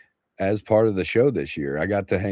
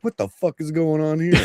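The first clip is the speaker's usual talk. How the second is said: pitched-up mock shock; higher, bewildered mock outburst; shocked coworkers; 7 semitones higher than usual. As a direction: play the crew walking in on a mess. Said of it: "they're like".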